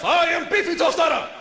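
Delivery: high, hammed-up announcement of the name; porn-star half. Other half of a guy taking over, high and brazen. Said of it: "The other half".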